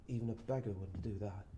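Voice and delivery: low, soft, appalled tramp; hushed judgement; quiet reproach. Acted. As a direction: say it low, in disbelief.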